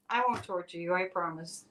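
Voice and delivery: usual speaking voice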